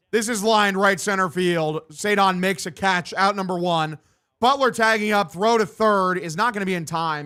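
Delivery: brisk sportscaster call of the hit; live play-by-play. Acted. Gamer calling his own ballgame, announcer style.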